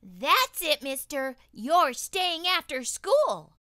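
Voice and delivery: high, loud stern teacher voice; scolding teacher. Acted. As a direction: scold him like a strict teacher. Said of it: "David's teacher always said".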